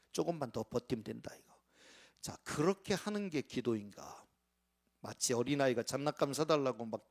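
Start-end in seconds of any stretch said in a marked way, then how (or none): 0.1-1.5 put-on scheming child. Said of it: "머릿속엔 벌써 딴 계산을".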